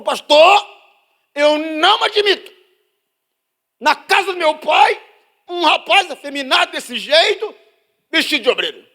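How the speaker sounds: hammed-up furious church lady; indignant sister. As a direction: protest with outrage. Angry sister complaining.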